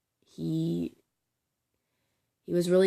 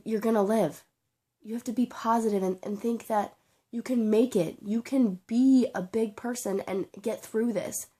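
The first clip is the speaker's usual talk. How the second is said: higher, heartfelt urging through real emotion; emotional pleading; 4 semitones higher, 3 dB louder than usual. Real, not acted. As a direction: urge them on earnestly, voice raised in pitch. Emotional plea to cancer patients.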